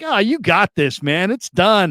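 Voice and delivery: high, cocky reassurance from a buddy; mock confident taunt. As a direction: tease him that it's already won. Friend teasing during game bet.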